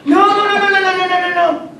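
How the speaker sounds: frantic high-pitched no's; panicked pleading. Acted. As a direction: beg in a panic, loud and high.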